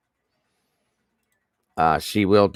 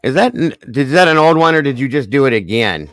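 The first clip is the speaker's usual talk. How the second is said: excited; 6 semitones higher, 5 dB louder, faster than usual. Real, not acted. Asking if gift is new.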